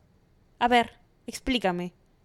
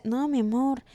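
ordinary delivery

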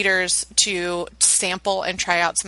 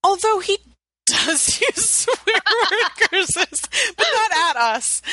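laughing; 12 semitones higher, slower than usual. Real, not acted. Amused about son's swearing.

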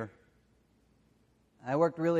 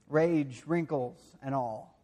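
normal talking voice

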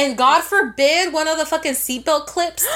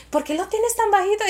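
higher-pitched mom questioning; lightly voiced, higher Spanish-speaking mom; imitating mom; 7 semitones higher than usual. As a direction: ask it like a querying mother. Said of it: "And my mom be like".

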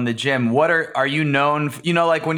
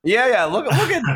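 higher, excited mock gym onlooker; impressed bystander; 9 semitones higher than usual. Gym-goer hyping up a surprise split, excited and high. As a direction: play an amazed gym-goer pointing it out. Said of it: "So everyone's probably like".

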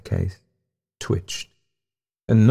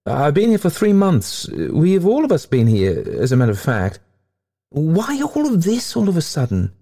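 high-pitched, agitated man objecting; protesting Winterslow; 10 semitones higher than usual. A narrator's reading. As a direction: protest in a high, put-upon voice.

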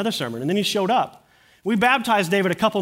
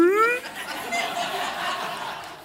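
embarrassed laugh; 4 dB quieter than usual. Real, not acted. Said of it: "it's kind of embarrassing".

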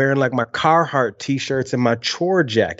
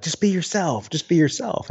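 quoted advice, higher and lightly tossed off; higher voice imitating a stock piece of advice; mock advice; 5 semitones higher than usual. TV people coaching a young reporter, mock advice. Retells the advice always heard in TV.

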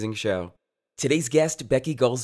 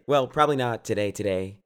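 ordinary delivery

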